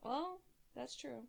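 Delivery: ordinary talk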